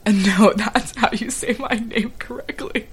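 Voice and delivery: slow, laughter-filled retelling; laughing. Retold or imitated. Retelling the friend's reply about his name, laughing through it. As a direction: barely get the quote out for laughing.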